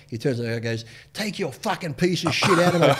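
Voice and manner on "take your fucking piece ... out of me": imitating an irate boss, loud and high; mock-furious dismissal, raised voice; angry outburst. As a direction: blow up at a salesman, retold with amusement. Acting gym owner's angry outburst.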